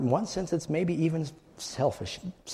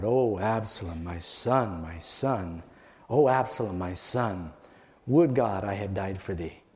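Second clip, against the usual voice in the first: mournful cry; 5 semitones lower than usual. Acted. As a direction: let the grief drain the voice away. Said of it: "this eerie, mournful cry".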